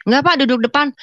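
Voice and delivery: acting out a cocky reply; proud insistence. Daughter-in-law insists to father-in-law on the front seat, proudly. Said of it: "Saya sombong sekali".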